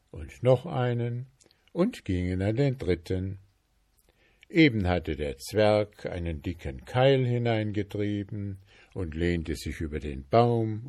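ordinary delivery, nothing marked

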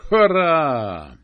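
cheering shout. Acted. Carnival cheering at the gate, a loud shout. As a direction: let out a joyful whoop. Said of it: "Sie dürfen nicht so schreien".

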